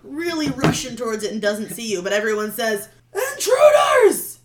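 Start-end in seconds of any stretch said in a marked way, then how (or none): 3.5-4.4 high, loud shout of alarm. Acted. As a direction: yell to raise the alarm.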